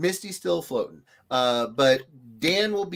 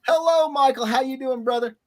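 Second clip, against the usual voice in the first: cheery greeting; 10 semitones higher, 4 dB louder than usual. Real, not acted. Greeting a chat viewer.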